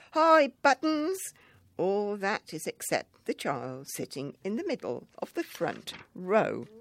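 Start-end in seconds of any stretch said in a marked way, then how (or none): 0.1-1.2 high, slow chorused reply; a hint of a crowd of kids calling back; children's shout. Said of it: "all the children would shout back".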